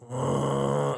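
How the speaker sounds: long, deep bear growl; deep drawn-out animal growl; imitated bear growl. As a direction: imitate a bear's low rumbling growl. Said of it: "like that, just a deep".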